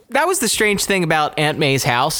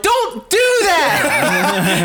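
high shouted plea; mock yell; 10 semitones higher, much slower than usual. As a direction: yelp at the screen. Reacting to movie gravestone.

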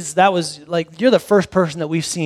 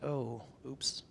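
sheepish oops; 5 semitones lower, 23 dB quieter than usual. Retold or imitated. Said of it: "was like".

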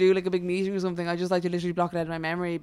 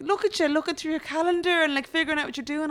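mock teasing friend; 9 semitones higher than usual. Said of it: "she was like".

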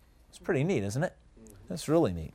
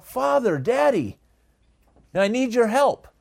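lightly acted higher-pitched plea; child appealing to dad; 6 semitones higher than usual. Child crying out to a dad for help, lightly acted higher plea. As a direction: call to dad for help in a higher voice, without shouting. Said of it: "cries out to that dad for help".

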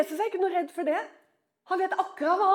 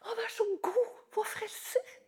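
softer, close to crying, almost whispered; tearful hush; 4 semitones higher, 10 dB quieter than usual. Acted. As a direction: breathe it out with tears in the voice. Maria moved by saviour. (nesten gråtende hvisking)

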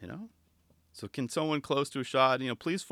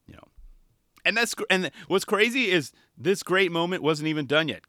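ordinary talk

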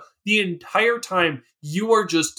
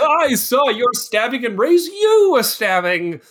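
high, loud, hammed-up boast; over-the-top playful bellow; mock triumphant shout; 8 semitones higher, 5 dB louder than usual. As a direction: shout it gloatingly, stretching the words. Joins in acting out the triumph.

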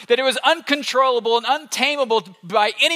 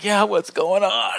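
sleepy Jesus; slower than usual. Pretend.

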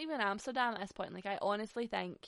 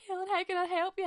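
higher, louder mock exasperated friend; imitating Gavin; 11 semitones higher, 7 dB louder than usual. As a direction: play the helpless friend, pitched up and loud. Voicing Gavin's baffled reply.

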